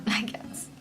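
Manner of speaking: soft breathy I guess; whispered giggle. Real, not acted. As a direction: laugh it off quietly.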